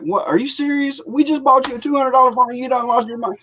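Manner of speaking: high, incredulous mock outburst; half-acted dad losing it over the phone; flipping out. Dad scolding daughter over lost phone, mock outrage. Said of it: "I was, like, flipping out".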